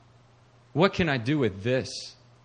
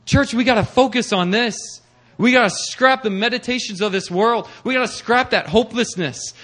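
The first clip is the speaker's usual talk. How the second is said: louder, higher, driving sermon voice; full-force preaching; 7 semitones higher, 7 dB louder than usual. Acted. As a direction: preach it hard and push every line to the back row. Preaching climax to congregation.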